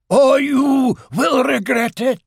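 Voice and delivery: very high, slow wailing voice; haunt him with long stretched vowels; ghostly. A narrator's reading. Fake uncle's ghost warning Owl off, ghostly. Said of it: "said the voice".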